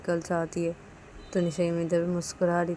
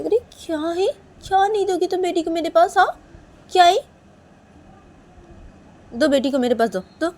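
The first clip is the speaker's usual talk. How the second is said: high-pitched, loud insistence; exasperated demand; 12 semitones higher, 10 dB louder than usual. Acted. Nisha demanding Manisha hand over baby, loudly exasperated. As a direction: push hard in a pitched-up voice.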